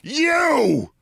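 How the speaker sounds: high, sharp accusing shout; shouted accusation. Retold or imitated. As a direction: point him out with a yell. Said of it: "I shout at Bill Maher".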